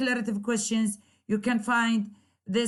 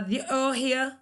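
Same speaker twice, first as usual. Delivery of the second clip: ordinary delivery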